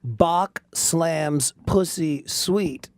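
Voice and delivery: very slow, spaced-out headline read; headline recital. Acted. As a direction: announce it one word at a time. Proudly quotes a headline.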